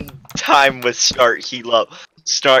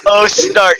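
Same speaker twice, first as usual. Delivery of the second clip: higher, swooning sigh of his name; dreamy sigh; 3 dB louder than usual. Acted. Lovestruck character sighing crush's name, swooning. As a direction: melt over the name. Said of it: "he thought to himself".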